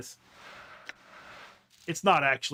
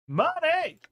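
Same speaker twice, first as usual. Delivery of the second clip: higher, louder gleeful cry; excited shout; 10 semitones higher, 4 dB louder than usual. Real, not acted. Player cheers on getting money, excited. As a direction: cheer at the payout.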